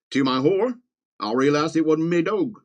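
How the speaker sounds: put-on Australian accent; hammed-up Australian accent. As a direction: read it as a comic Aussie. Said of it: "I'll stop doing an accent".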